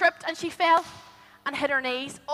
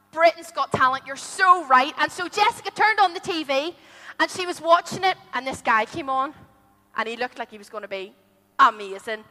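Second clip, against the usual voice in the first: ordinary delivery, nothing marked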